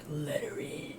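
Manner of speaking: hushed broody whisper. Retold or imitated. Bill broods in dark corner.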